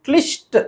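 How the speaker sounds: क्लिष्ट with short i and strong sh; high, emphatic "klish-ta" for क्लिष्ट. Shown as a demonstration. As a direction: lean on ष्ट in क्लिष्ट. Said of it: "सही है".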